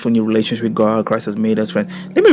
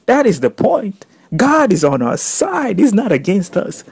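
fervent emphasis; 7 semitones higher than usual. Real, not acted. Preacher stressing his point.

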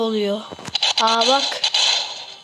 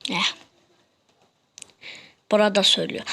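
normal talking voice

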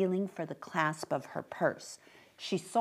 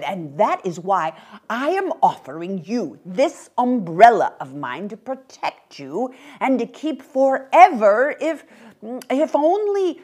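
put-on loud, higher, hopeful old man; eager little old gentleman; 5 semitones higher, 10 dB louder than usual. Old man offers umbrella to mother, eager then hesitant.

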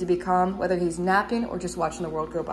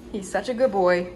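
normal talking voice

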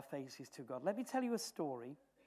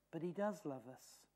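normal talking voice

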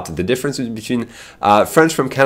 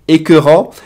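emphatic; 7 semitones higher, 7 dB louder than usual. Shown as a demonstration. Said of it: "emphatic demo".

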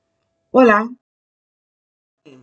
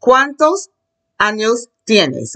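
slow, word-by-word model for learners; 2 semitones higher, slower than usual. Shown as a demonstration. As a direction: separate each word with small pauses. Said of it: "I can't do slow".

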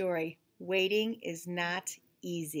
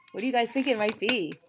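usual speaking voice

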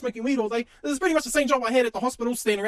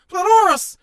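high, loud blurted shout; 7 semitones higher, 9 dB louder, slower than usual. Acted. Commentator yelling the word, high and loud. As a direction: bark the word out.

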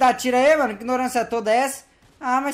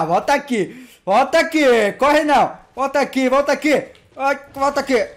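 louder, slow come-back-here yell; loud taunting call; 3 semitones higher, 5 dB louder than usual. Acted. Chasing fleeing players, yells loudly for them to return. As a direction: call after them as they run.

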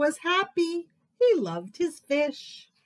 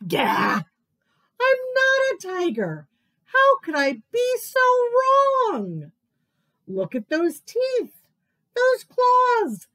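much higher, louder startled outburst; dramatic realization; 11 semitones higher, 8 dB louder than usual. Acted. Crocodile realising his mistake, startled high outburst. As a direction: gasp and blurt it out.

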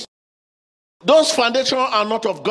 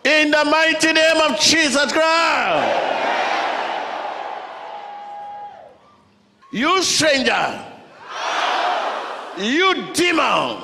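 shouting faith healer; 4 semitones higher, slower than usual. Acted. Preacher casting out demons.

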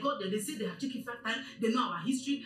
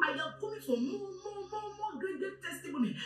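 high-pitched, insistent repetition of "more"; fervent declaration; 10 semitones higher, 3 dB quieter than usual. Real, not acted. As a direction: claim it fervently, pitch soaring. Fervent closing claim of more testimonies.

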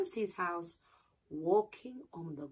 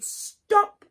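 shouting; 8 dB louder, much slower than usual. A narrator's reading. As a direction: bark the order to halt. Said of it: "Anansi shouted".

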